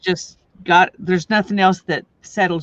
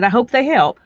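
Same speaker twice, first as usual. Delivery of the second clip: louder and higher, repeating; repeated louder; 6 semitones higher, 6 dB louder than usual. Real, not acted. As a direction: say it again, clearer and louder for her. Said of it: "I said".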